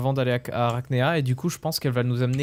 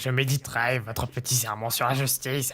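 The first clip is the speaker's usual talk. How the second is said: lightly hammed-up evil villain. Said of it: "j'ai une voix de méchant".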